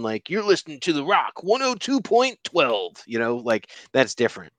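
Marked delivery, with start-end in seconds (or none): 0.3-3.0 higher, punchy radio station ident. Shown as a demonstration. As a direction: announce it like a rock station DJ. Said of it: "like, if you're doing".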